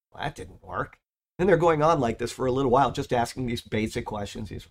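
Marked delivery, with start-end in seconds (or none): none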